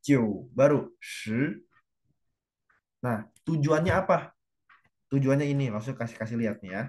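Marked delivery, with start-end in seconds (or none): 0.0-1.6 "Jiu" low and short, then "shi" climbing; low nine, rising ten. Shown as a demonstration. Teacher joins nine and ten, "Jiu" low then "shi" rising. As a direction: keep "Jiu" down, save the rise for "shi". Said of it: "jiunya juga langsung rendah doang".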